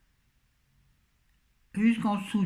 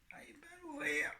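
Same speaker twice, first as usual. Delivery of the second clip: strained whine; 12 semitones higher, 12 dB quieter than usual. Real, not acted. Frustrated at stuck lid.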